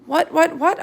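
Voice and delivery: high, loud, rapid-fire squeaky questions; eager hamster. Acted. The hamster begging the teacher for the secret, high and loud. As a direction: bounce with eager curiosity.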